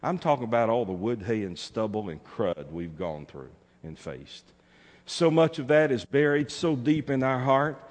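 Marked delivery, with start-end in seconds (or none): none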